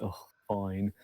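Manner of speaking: quiet, low reluctant agreement; soft grumbled consent; begrudging. Acted. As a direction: give in grudgingly, under your voice.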